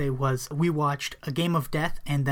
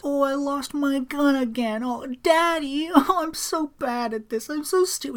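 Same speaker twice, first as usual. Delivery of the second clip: high, slow, whimpering self-pity; high, drawn-out whining to her daddy; whiny daughter; 11 semitones higher, slower than usual. Retold or imitated. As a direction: pout it out like a helpless daughter. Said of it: "it's great because she goes".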